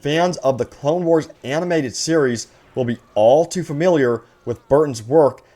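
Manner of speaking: usual speaking voice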